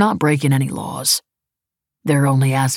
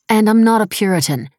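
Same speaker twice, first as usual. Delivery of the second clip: higher, louder retort with mild indignation; defensive protest; 6 semitones higher, 5 dB louder than usual. A narrator's reading. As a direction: push back at the accusation. Defends herself to Lemoyne.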